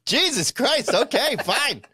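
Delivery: high-pitched, hammed-up exasperated complaint; mock exasperation. Acted outburst in umbrella bit.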